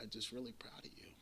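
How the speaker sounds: hushed, nearly whispered words from dad; quiet emotional quote. Retold or imitated. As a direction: let it drop to a breathy hush, moved. Dad's emotional call quoted.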